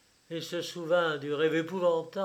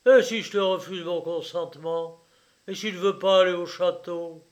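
loud, pressing questions; defiant threat; 3 semitones higher, 9 dB louder than usual. A narrator's reading. Father threatening to refuse his son's marriage, defiant threat. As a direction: challenge him forcefully, gathering speed.

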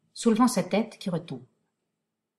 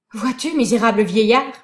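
loud; 8 dB louder than usual. Acted. Hernani defiant cry at old man.

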